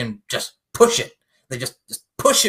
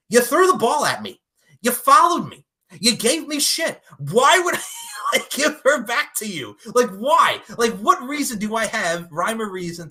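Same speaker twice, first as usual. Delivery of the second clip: high, loud mock outrage; indignant protest; 7 semitones higher, 5 dB louder than usual. Villain's side argued against keeping the bargain, indignant protest. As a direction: list the grievances, hitting each one hard.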